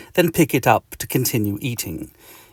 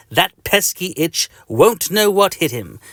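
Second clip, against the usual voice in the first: higher villainous gloat; 8 semitones higher, 4 dB louder than usual. Acted. Patient plots revenge on the itch, gloating. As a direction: relish the coming revenge.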